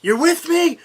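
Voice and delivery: rally the cops like a crusader; rousing speech shout. Retold or imitated. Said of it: "he gives that big speech".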